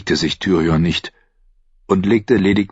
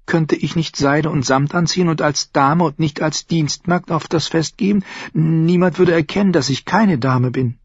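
junge Frau, höher gesprochen, neugierig; Shay; 10 semitones higher than usual. Acted. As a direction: fragt ihn leise drängend aus. Shay träumt vom Fest. (Shay, junge Frau, höhere Stimme)

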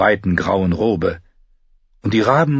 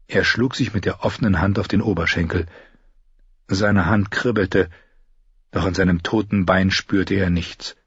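plain reading voice

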